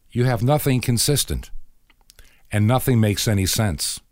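ordinary talk, nothing marked

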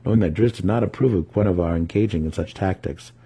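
plain reading voice

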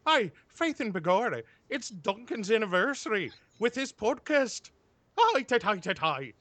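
mock leprechaun greeting; bad Irish accent. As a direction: friends doing a terrible Irish accent to a podcaster. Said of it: "with a bad accent".

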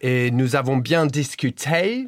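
authentic native French accent; too French accent. Shown as a demonstration. Guest's impression slips as he describes chatting, native French accent. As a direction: slip into real French pronunciation. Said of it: "a little bit too French".

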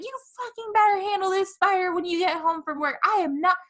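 put-on yelling at her laughing boyfriend, outraged; mock outrage. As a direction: rail at him, scandalised. Furious rant at boyfriend.

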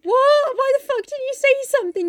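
high-pitched outraged disbelief; hammed-up indignant husband; mock exasperation. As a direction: burst out in comic exasperation. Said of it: "and he's like".

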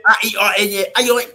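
high and loud, spelling out "Aione" letter by letter. Retold or imitated. (soletrando "Aione" alto, letra por letra)

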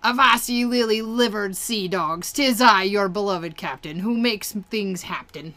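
loud, swaggering pirate captain. Acted. Game captain addressing the sea dogs, loud pirate voice. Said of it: "he says".

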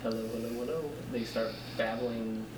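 faint and far off-mic; barely picked up by the mic; turned away from mic. Real, not acted. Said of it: "it's only barely coming through".

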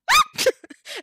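sudden very high, loud yelp. Acted. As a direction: let out a startled squeak of a yelp. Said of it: "like, yelp".

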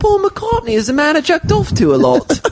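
high, hammed-up British voice; mock British accent. Cohost joking about [name], high British accent. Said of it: "do a British accent".

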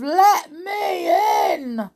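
high, drawn-out demand; shouting. Acted. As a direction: shout it spookily from outside the door. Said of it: "the shouts".

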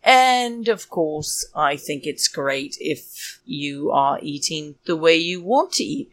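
ordinary talk

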